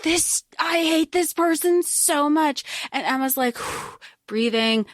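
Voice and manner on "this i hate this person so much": exasperated. Retold or imitated. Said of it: "And I was like".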